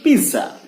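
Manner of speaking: "पिज्जा" as PI-za, Z without T; wrong Z sound. Shown as a demonstration. Teacher showing another mistake, wrong Z sound. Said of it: "ना कि पिज्जा".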